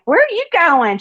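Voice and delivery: surprised question. Retold or imitated. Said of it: "I'm like".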